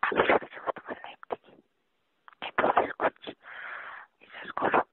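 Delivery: usual speaking voice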